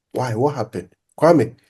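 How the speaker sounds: normal talking voice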